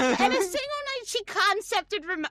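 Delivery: high, loud excited echo; amazed emphasis. Real, not acted. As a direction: marvel loudly at the speed of it.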